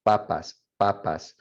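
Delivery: slow papas with unaspirated Ps; Spanish P. Shown as a demonstration. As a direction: say "papas" slowly, no air on the ps. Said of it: "you shouldn't feel any air on the Ps".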